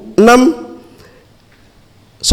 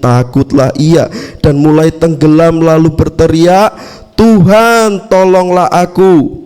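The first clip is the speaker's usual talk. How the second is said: louder, steady Bible reading to the congregation; scripture reading; 3 semitones lower, 3 dB louder than usual. Acted. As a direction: read the passage out clearly, verse by verse. Reads the Bible passage aloud.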